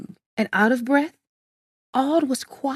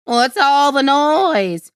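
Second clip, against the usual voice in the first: loud, stretched-out question; crying out; 3 semitones higher, 6 dB louder, slower than usual. A narrator's reading. Villager hearing banging from the mountainside, crying out. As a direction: call out over a din. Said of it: "someone cried".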